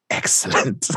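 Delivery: hushed, breathy villain's relish; lightly voiced sinister mastermind, softly; Magneto. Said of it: "Magneto shows up and he's like".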